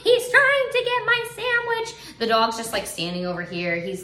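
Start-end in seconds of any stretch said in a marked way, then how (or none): 0.0-2.2 put-on very high, drawn-out child's wail; imitating a crying little kid, high and slow; four-year-old's meltdown. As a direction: wail like a small child mid-tantrum. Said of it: "this meltdown that my four-year-old's".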